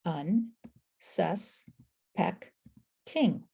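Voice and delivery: "Un, sus, peck, ting" with a pause after each; four separated syllables, "Un, sus, peck, ting"; chunk by chunk. Shown as a demonstration. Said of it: "How many chunks do you hear".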